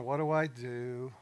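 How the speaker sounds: slow, drawn-out question to himself; musing, stalling for effect; pondering. Retold or imitated. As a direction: stretch it out as if thinking it over. Acting out pondering with a pause.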